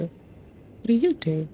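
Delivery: slow mock-puzzled drawl; slow drawn-out question. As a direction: stretch every word out slowly. Repeats question, puzzled at menu.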